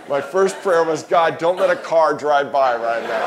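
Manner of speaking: 웃음 섞인 큰 목소리로 천천히; 웃음을 참지 못하며 기도를 재현; laughing. Real, not acted. Retold prayer, laughing while telling.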